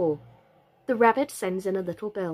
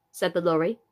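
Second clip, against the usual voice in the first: usual speaking voice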